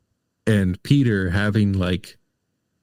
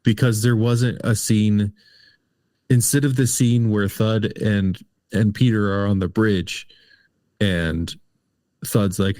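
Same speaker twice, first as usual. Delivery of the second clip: ordinary delivery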